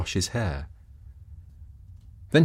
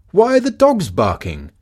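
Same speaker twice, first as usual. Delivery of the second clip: calling out; 9 semitones higher, 7 dB louder than usual. Acted. Husband calls out.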